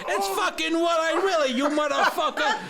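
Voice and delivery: high, brash New York tough guy; Joe Pesci impression. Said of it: "Joe Pesci in The Goonies".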